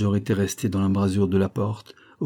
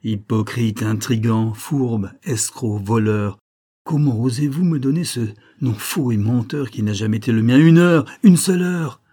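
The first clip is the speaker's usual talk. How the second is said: anger and contempt; 3 semitones higher, 7 dB louder than usual. A narrator's reading. Olivia denouncing the major's lies, rising anger. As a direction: build from scorn to furious outcry. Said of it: "sa colère et son mépris".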